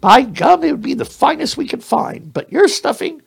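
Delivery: very high, squeaky, earnest mouse voice; apologetic mouse. Acted. Mouse apologising to the bear for the stuffing, high squeaky voice. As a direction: apologise in a fussy little squeak.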